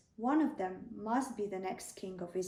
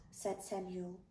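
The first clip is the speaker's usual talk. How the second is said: usual speaking voice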